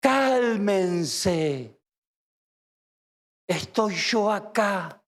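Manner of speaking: calm them, unhurried and steady; soft but firm. Acted. Jesus answers his frightened disciples, low slow and firm. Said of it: "la voz suave del Señor, pero contundente y rotunda".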